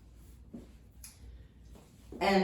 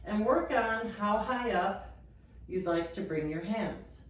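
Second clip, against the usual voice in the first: ordinary delivery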